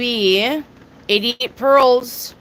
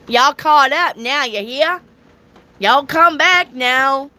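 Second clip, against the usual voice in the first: playful, higher-pitched Southern drawl; southern accent; 4 semitones higher, 3 dB louder than usual. Shown as a demonstration. Reader shows off her accent to viewers, Southern drawl. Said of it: "my southern accent".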